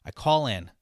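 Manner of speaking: ordinary talk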